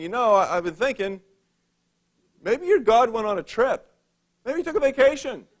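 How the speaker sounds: sarcastic taunt rising high at the end; smart aleck mockery. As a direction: needle them with mock sympathy. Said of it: "He's kind of a smart aleck".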